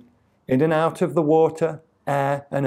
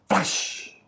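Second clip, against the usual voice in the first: whispered, hissing flash of lightning; voiceless lightning sound effect; whooshed flash; 7 semitones higher, 5 dB quieter than usual. A narrator's reading. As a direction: breathe it out as a sharp crackling effect.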